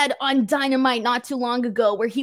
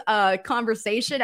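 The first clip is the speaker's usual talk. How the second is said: usual speaking voice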